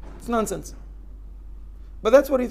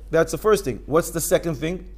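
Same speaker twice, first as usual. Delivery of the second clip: usual speaking voice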